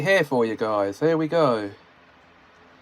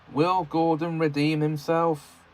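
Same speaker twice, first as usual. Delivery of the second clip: ordinary delivery, nothing marked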